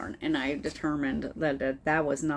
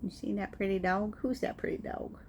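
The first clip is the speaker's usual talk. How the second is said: much higher, cooing pet voice; 5 semitones higher, 7 dB quieter than usual. Real, not acted. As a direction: coo over the sweet dog. Baby talk to her dog.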